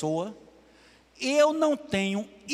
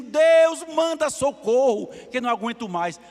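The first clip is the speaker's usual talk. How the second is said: loud, high cry to God for help; mock anguished prayer, raised high and loud; desperate retold prayer; 4 semitones higher, 7 dB louder than usual. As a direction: plead like a woman at the end of her rope.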